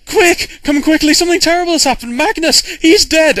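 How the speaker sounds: high, loud cry of alarm; urgent, high-pitched panicked yelling. Acted. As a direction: shout the dreadful news in a panic. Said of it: "sort of in this panic voice".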